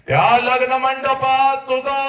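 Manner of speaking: slow, very high wail; soaring chant-like lament; impassioned climax. Acted. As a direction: stretch words on a high held note.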